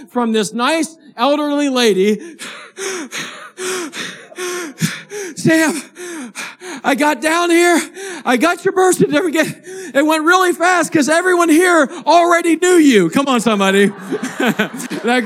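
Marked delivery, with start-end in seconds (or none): 2.3-4.1 gasp. Retold or imitated. Phone call from the elderly lady. 5.3-13.1 high, bright-pitched, louder, laughing through the news; bursting with happy news; excited, laughing lady. Retold or imitated. Elderly lady on the phone tells Sam the news, laughing excitedly.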